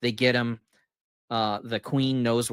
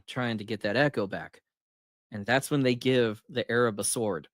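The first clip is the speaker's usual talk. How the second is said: ordinary talk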